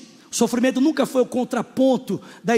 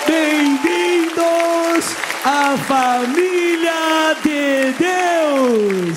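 hammed-up booming church greeter; mock big preacher; 3 semitones higher, 4 dB louder, much slower than usual. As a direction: stretch every word like a showy pulpit welcome. Mimicking a loud slow preacher.

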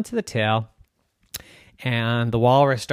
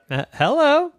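put-on timid girl voice; 6 dB louder than usual. Girl answers walrus.

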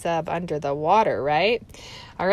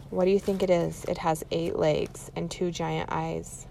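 ordinary delivery

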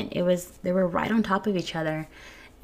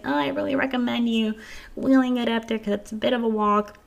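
a lightly voiced guard, pitched higher; a hint of a courteous security guard giving advice; polite security guard; 5 semitones higher than usual. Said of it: "the security from the emergency department was like".

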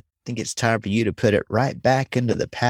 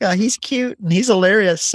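put-on childlike delight; 10 semitones higher, 3 dB louder than usual. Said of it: "you're like".

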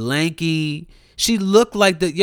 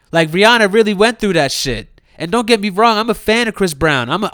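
normal talking voice

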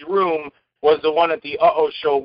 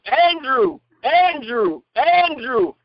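higher-pitched repeated call, each falling; mock calling out; 7 semitones higher, much slower than usual. Director calls to actor across set, imitated calling. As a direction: imitate calling someone to stop.

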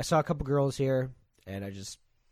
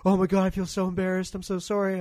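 high, louder mock apology; imitating embarrassed girlfriend; 8 semitones higher, 8 dB louder than usual. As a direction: gush it in her put-on voice. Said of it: "she's like".

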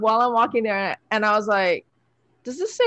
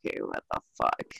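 muttered aside; 10 dB quieter than usual. Retold or imitated. Muttering in seminar seat.

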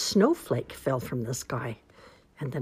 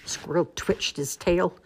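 ordinary delivery

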